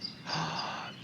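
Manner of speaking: a fake whispered gasp of shock; hushed pretend gasp at a mistake; mock gasp. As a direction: gasp in pretend horror under the breath. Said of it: "Just kidding".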